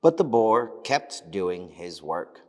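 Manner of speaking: ordinary delivery, nothing marked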